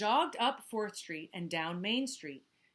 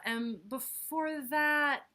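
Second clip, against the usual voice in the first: "And before that" drawn out slowly; 5 semitones higher, 4 dB louder, much slower than usual. Acted. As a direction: stretch "And before that" wearily. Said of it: "refrain slowed".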